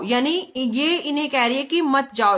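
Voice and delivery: ordinary talk, nothing marked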